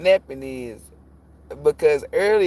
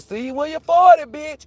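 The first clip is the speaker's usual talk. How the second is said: high sassy taunt, higher pitched; mock Archangel Michael; 10 semitones higher, 4 dB louder than usual. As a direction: toss the line off like a cheeky higher voice. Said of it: "And then the Archangel Michael".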